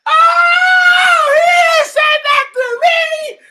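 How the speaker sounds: high-pitched stunned shout; outraged disbelief. Real, not acted. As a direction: shriek it in disbelief at the insult. Shocked at a comment.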